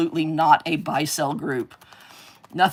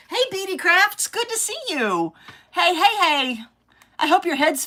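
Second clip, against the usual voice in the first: much higher sing-song hello; bright greeting; 13 semitones higher, 3 dB louder than usual. Real, not acted. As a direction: greet a returning viewer happily. Greeting viewer in chat.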